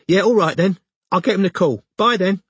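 high-pitched London woman signing off; casual, high London goodbye; London accent. A narrator's reading. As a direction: wrap up the call in a London accent. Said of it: "She had a London accent".

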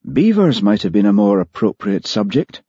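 plain reading voice

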